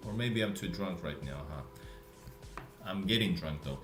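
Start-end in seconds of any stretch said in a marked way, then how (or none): none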